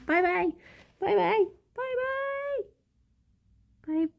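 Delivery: rising to a very high squeak; squeaky bye. Real, not acted. Streamer saying cute goodbyes to chat, very high.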